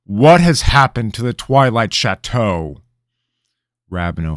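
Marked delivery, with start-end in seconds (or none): 0.0-2.8 loud, high royal demand; king demanding an answer from the boy; commanding. A narrator's reading. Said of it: "he commanded".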